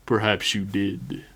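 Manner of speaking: slow, musing reverend; understated, measured thoughtfulness; thoughtful. A narrator's reading. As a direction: muse on it slowly, lightly in character. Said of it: "the Reverend said thoughtfully".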